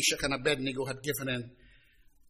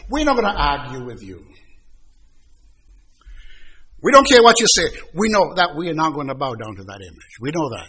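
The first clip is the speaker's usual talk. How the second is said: defiant refusal; 10 dB louder than usual. Acted. Three young men defying the king.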